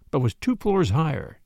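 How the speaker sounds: ordinary delivery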